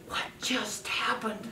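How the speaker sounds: small whispered wonder; whispered question. Retold or imitated. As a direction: whisper it like a puzzled little girl. Little granddaughter reacting in car.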